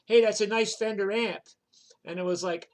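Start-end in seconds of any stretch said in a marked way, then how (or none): 0.0-1.4 higher, louder friendly hail; punk stranger's greeting. Retold or imitated. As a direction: call out a loud hello, imitating him. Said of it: "the guy goes".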